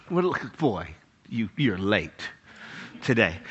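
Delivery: low, slow, soft cat voice; disdainful cat. Retold or imitated. Cat to owner arriving late, low slow disdain. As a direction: scold quietly and unhurriedly. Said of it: "My cat would go".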